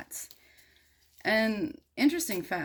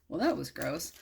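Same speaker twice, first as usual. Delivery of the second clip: normal talking voice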